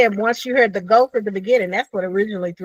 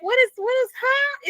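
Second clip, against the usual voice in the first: confused surprise. Retold or imitated. Confused by ghost voice.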